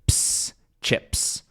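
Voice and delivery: "ps" then "chips" whispered crisp; sound by sound. Shown as a demonstration. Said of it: "ch, which is".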